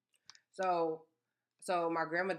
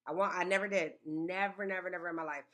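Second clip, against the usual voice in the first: usual speaking voice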